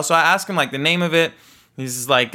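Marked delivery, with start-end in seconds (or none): none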